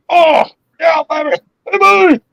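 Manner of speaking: very high-pitched celebratory shout; excited whooping. Real, not acted. Angler celebrating a personal best, excited high whoop.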